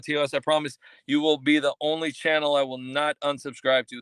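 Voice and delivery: normal talking voice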